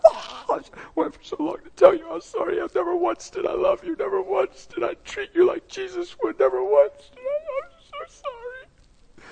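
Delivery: very high, squeaky crying voice; high-pitched weepy confession, fading at the end; imitated tearful apology. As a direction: squeal it through mock sobs, thinning out. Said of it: "And I'm just".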